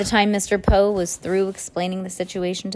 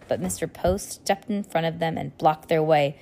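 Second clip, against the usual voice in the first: usual speaking voice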